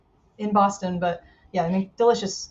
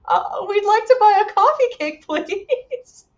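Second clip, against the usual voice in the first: startled customer; 10 semitones higher, 4 dB louder than usual. Retold or imitated. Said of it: "We're like".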